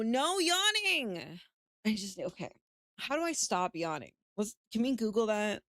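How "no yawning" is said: high, slow stern no; self-scolding. Real, not acted. Streamer scolding herself mid-yawn. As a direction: drag it out to stop herself yawning. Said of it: "scolding herself for yawning".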